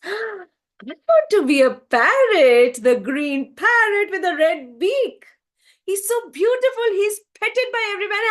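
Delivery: high, loud burst of delight; excited wishing. A narrator's reading. Swan tells the sage its wish, excited and high. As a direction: gush about a dream come true. Swan gasps, announces dream creature.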